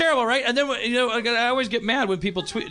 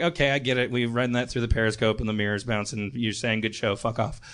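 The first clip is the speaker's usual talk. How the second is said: lower, dry mock reply; sarcastic reply; 8 semitones lower than usual. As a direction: answer the troll in a deadpan, lowered voice. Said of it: "And I'm like".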